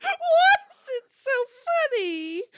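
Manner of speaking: helpless tearful laughter; laughing so hard it turns to crying. Real, not acted. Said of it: "Stop, I'm crying".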